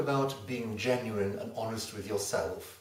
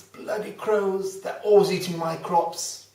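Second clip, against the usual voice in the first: high, loud, indignant grumbling; angry farmer; 9 semitones higher, 10 dB louder than usual. Acted. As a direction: exclaim it like a fed-up farmer, stretching the first words. Farmer rages at the crow.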